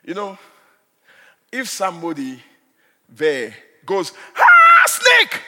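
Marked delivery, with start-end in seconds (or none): 4.4-5.4 high, loud alarmed cry; panicked shout. Retold or imitated. Someone in church spotting a snake, panicked shout. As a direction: yell it like you just saw a snake.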